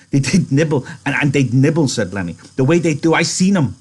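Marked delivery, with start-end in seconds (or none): 0.1-1.9 high, loud, stammering delight; excited. A narrator's reading. Lenny excited about rabbits. 2.6-3.7 high, loud, eager voice; excited. A narrator's reading. Lenny excited about rabbits.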